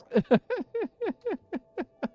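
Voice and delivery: high, loud cackle; laughing. Real, not acted. Streamer cracking up at own joke name, high laughter. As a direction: keep giggling helplessly, high and long.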